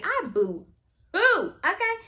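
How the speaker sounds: high, loud mock boo-hoo; mock boo-hoo sob. Real, not acted. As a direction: playfully act out bawling.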